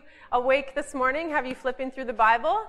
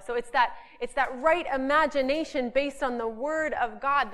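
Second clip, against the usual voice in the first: ordinary talk, nothing marked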